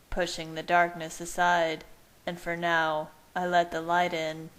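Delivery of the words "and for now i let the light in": ordinary delivery, nothing marked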